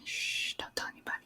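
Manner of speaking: hushed secret whisper. Real, not acted. Confessing she didn't plan.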